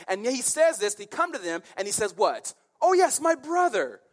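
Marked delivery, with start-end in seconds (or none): 2.8-4.0 high, loud gushing exclamation; hammed-up delighted king; mock enthusiasm. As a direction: hail him like a long-lost relation. Mocking Ahab's foolish exclamation.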